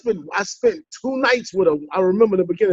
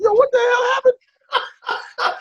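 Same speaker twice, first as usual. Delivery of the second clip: shocked cry; slower than usual. Retold or imitated. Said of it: "I said".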